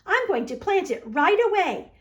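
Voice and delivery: high, eager thank you; a child bursting with joy; excited thanks. A narrator's reading. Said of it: "cried Franklin".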